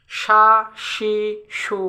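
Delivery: সা, সি, সু said with sh: sha, shi, shu; shin pronunciation. Shown as a demonstration. Teacher introducing the letter shin, clear sh sounds. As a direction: turn the s of সা, সি, সু into a full sh. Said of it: "শিনের উচ্চারণটা কেমন হবে".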